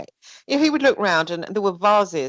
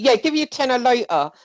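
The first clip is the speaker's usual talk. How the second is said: higher, louder, offhand promise; mock breezy taker; 6 semitones higher, 4 dB louder than usual. Shown as a demonstration. Showing Jonna how the grabber says it, higher and louder. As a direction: toss the promise off lightly. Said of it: "like that".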